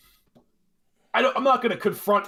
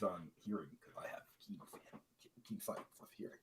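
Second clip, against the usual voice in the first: trailing off to a near whisper; mumbled; 8 semitones lower, 22 dB quieter than usual. Real, not acted. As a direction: mumble it too quietly to hear. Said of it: "What did you say?".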